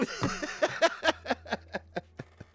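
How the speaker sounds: high, breathy staccato cackle; high burst of laughter trailing away; long, fading high-pitched laugh. Acted. Mock panic ends in wordless outburst.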